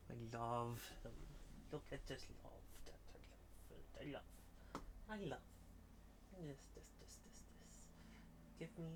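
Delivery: tender half-whisper; whispered pet talk. Real, not acted. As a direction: hush it lovingly to the cat. Whispering love to cat.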